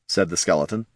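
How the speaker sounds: ordinary delivery, nothing marked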